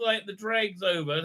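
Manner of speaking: ordinary talk, nothing marked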